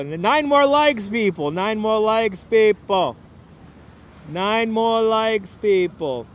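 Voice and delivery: slow, playful appeal to the crowd; calling out. Pretend. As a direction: stretch it out like a street crier. Calling viewers for more likes.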